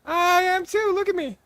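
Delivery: put-on squeaky cute VTuber girl; cute anime streamer. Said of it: "I could just try to do, like, a voice".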